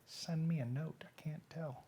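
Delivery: whispered aside. Real, not acted. Host whispering to the producer during the live stream. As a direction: whisper it so the viewers can't hear.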